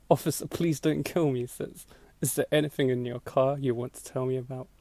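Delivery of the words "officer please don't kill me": ordinary talk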